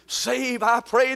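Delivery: normal talking voice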